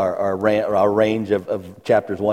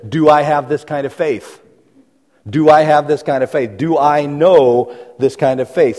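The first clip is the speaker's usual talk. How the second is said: raised, louder preaching that builds through the list; preaching at full force; 5 semitones higher, 7 dB louder than usual. Acted. Preacher pressing the congregation about their faith, in full-force preaching. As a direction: drive it home to the congregation with rising force.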